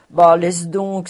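loud, scoffing brush-off; loud dismissal. A narrator's reading. Schultz brushes off Cobus, loud and scoffing. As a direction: wave it away loudly. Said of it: "s'écria Schultz".

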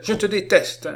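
put-on high, loud childish loathing; crying out. A narrator's reading. Girl rejecting Lecoq at the door, crying out. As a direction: hurl it at him like a furious child. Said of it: "s'écria-t-elle".

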